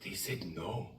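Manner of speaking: whispered, barely audible; hushed shame. Retold or imitated. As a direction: whisper to Troy that they refused. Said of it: "retold tearful reply".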